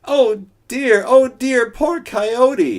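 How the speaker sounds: high, loud mock woe; high loud self-pitying wail; lament. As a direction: pity yourself theatrically. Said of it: "he lamented".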